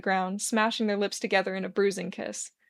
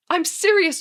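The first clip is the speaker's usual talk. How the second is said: insisting; 9 semitones higher, 7 dB louder than usual. A narrator's reading. Arthur insisting, nauseous.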